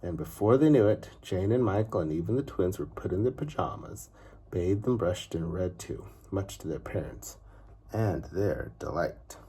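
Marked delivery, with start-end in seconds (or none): none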